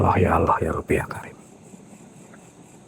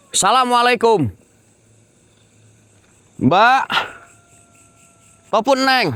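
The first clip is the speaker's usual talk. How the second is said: calling out to a spirit; 13 semitones higher than usual. Acted. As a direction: hail the unseen woman across the dark. Calling out to ghosts at night.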